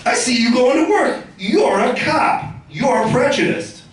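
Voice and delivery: high, slowing mock accusation; accusing neighbour. Neighbour accusing an off-to-work cop, mock indignant. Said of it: "He goes".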